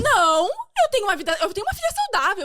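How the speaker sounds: high, loud, firm voicing of mother; defiant mother. Guest's mother refusing the diagnosis, high loud defiant quote. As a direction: refuse the news, loud and resolute. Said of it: "Ela falou assim".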